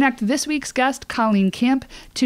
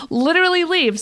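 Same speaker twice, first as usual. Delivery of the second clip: mock exasperated yell; 8 semitones higher than usual. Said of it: "She was like".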